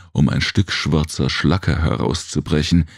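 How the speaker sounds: plain reading voice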